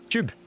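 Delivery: Tube said as "chube"; broad Scottish tube. Shown as a demonstration. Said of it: "you have to pronounce it tube".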